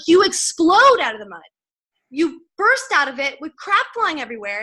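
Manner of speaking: fired-up motivational speaker, pitched high; retold rallying cry, loud and high at the start; John Addison. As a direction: imitate a rousing stage line, punching the key word. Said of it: "getting really fired up".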